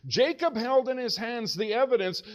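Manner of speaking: ordinary delivery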